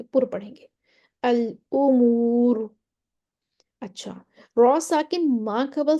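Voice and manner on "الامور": الامور drawn out very slowly, ر thick; heavy ra recitation. Shown as a demonstration. Teacher reciting الامور to students, slow with heavy ر. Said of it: "را کو موٹا پڑھیں گے".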